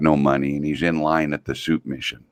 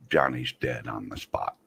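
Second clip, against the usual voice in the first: hushed punchline; 5 semitones lower, 10 dB quieter than usual. Real, not acted. Deadpan punchline of the joke.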